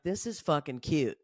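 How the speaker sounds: a condescending little eye-roll of a line; patronising sarcasm, quiet; sarcastic aside. Retold or imitated. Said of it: "I watched that and I was like".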